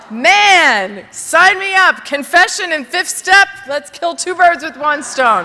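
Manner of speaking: jumping at the chance; eager enthusiasm. Retold or imitated. Her eager reply to the priest.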